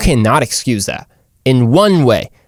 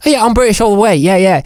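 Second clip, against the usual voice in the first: higher-pitched mock British accent; 7 semitones higher than usual. Imitating British people who switch accents, mock British accent. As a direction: play a Brit in an exaggerated British accent. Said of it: "British people can be like".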